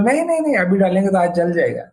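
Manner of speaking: high-pitched imitation of a child refusing; mock alarmed refusal. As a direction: act out a child's protest, pitch leaping up on the first words. Acted child refusing after a burn.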